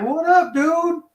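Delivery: high-pitched, put-on "what up" greeting; mock street greeting. Caller trying to relate to the dispatcher, mock street greeting. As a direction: imitate your own chummy street-slang hello, pitched high.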